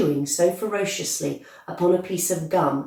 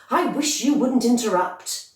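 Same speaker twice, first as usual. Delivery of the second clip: annoyed; 6 semitones higher than usual. A narrator's reading. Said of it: "he said angrily".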